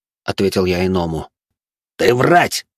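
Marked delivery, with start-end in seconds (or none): 2.0-2.7 barking angry alien accusation; barked. Acted. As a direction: bark it out, sharply. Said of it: "рявкнул на меня собеседник".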